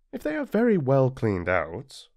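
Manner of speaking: ordinary delivery, nothing marked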